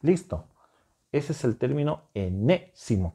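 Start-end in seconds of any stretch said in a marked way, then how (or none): 2.1-3.1 "enésimo" broken up, leaning on the accented "né"; stress on NÉ. Shown as a demonstration. Teacher showing students the accent in "enésimo", stressed on "né". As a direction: say "enésimo" in pieces, stressing the second E. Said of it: "con acento en la E".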